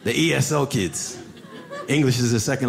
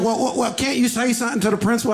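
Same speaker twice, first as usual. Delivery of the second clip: put-on higher, pleading kid voice; 8 semitones higher than usual. Student begging teacher to talk to the principal, pleading kid voice. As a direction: beg like a teenager in trouble. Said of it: "He said".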